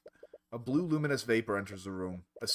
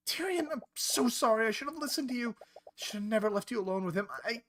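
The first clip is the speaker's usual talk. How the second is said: tearful apology; 9 semitones higher than usual. Acted. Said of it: "Tears are streaming down her eyes".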